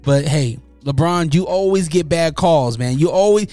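ordinary talk, nothing marked

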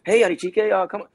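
high, cheery welcoming voice; friendly staffer. Retold or imitated. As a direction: greet him brightly. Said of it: "on the set".

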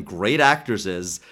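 usual speaking voice

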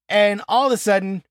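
normal talking voice

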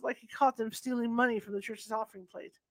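plain reading voice

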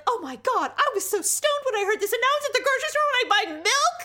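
high-pitched, hammed-up disbelief; imitating shocked parents. Imagines parents' shocked reaction.

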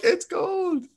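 high squeak. Retold or imitated. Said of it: "but I'm like".

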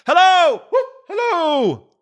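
high, loud whooping call; delighted far-carrying hail; excited hallooing. A narrator's reading. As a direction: holler it out with boyish glee. Scrooge hailing his storybook friends.

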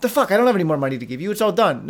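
rushed, exasperated outburst; hurried, fed-up refusal; exasperated counterexample. Shown as a demonstration. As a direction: blurt it out fast, as the wrong way to say it. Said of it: "Rather than saying like".

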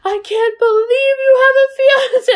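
high, loud mock dismay of a jealous kid; mock shocked girl. Friend retelling the movie girl's shock, mock squeal. Veda voiced reacting to fiance.

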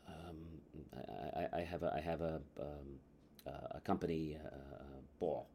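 very low, quiet, halting mumble with fillers; hesitant customer. Acted. Shoe shopper asked about the occasion, hesitant and quiet. Customer reluctantly answers prying salesperson.

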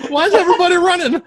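very high, loud, quick squeak; hurried high-pitched cartoon cry; penis impression. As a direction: squeal it fast like a puzzled little creature. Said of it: "That's my penis impression".

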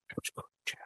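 soft breathy whisper. Acted. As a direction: whisper it like a secret. Savoring Jello, fading.

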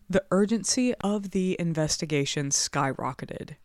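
usual speaking voice

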